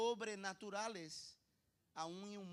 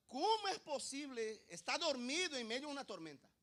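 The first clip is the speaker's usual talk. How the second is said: acted astonished protest, pitched up and loud; incredulous question; 7 semitones higher, 8 dB louder than usual. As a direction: demand to know how on earth. Voices listener's incredulous question.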